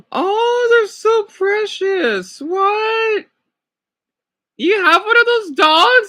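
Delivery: high, squealing gushing over each photo; gushing over cute dogs. Real, not acted. Streamer cooing at dog photos on her phone, high and gushing. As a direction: coo like you just saw the cutest puppy.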